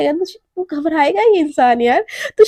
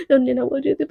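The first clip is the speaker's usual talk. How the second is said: usual speaking voice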